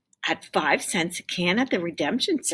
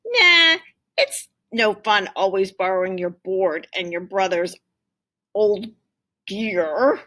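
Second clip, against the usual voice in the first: glum refusal; 4 dB louder than usual. Acted. Tim turns down skateboarding.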